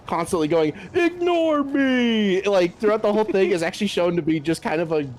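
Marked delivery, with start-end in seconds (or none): 0.9-2.4 slow, drawn-out, higher cry of "Ignore me!"; Galactus parody catchphrase. Retold or imitated. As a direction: stretch it out like a pleading cosmic giant.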